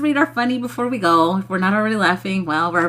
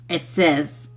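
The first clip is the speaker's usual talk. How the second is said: ordinary talk, nothing marked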